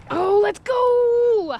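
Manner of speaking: high, loud, slow drawn-out cheer; thrilled drawn-out whoop; excited cry. Real, not acted. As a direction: shout with joy at the catch. Catching a fish, excited.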